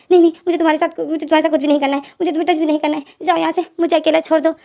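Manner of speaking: frantic yell from under the blanket; panicked shout. A narrator's reading. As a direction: push him away with a frightened yell. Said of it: "यही बोल रहा होता है".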